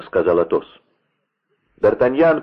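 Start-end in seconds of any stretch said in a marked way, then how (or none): none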